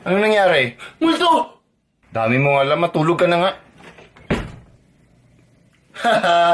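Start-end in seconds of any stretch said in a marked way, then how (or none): 1.0-1.6 startled cry. Acted. Sees a ghost at night. 2.1-3.5 scolding. Acted. Parent scolds child at night.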